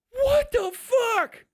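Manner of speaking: high, drawn-out exasperated wail; outraged cry. Acted. Robot whose task is taken away, outraged wail. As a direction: cry out slowly in high-pitched disbelief. Acts out outrage at being denied.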